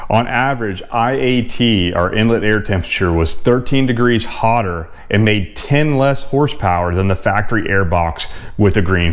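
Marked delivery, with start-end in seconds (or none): none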